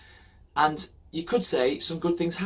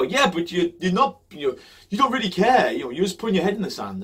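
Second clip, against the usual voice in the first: mock critic; 6 semitones higher, 7 dB louder than usual. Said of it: "And then people go".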